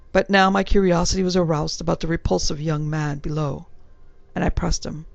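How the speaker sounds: usual speaking voice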